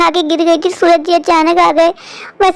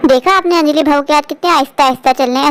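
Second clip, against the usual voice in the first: normal talking voice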